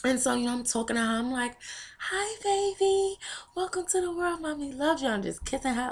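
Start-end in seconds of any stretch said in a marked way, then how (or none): 2.0-5.1 put-on high, soft baby talk; high-pitched, gentle mommy voice; cooing to newborn. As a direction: welcome a newborn with a tender coo. Retold talking to her newborn.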